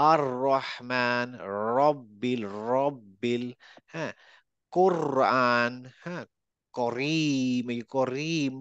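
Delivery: slow words, each র rolled. Shown as a demonstration. Teacher slowly modelling words with a rolled র. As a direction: stretch each word and trill its র. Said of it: "rolling র".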